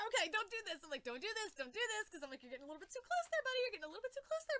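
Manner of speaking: high, anxious mock pleading; nervous pleading.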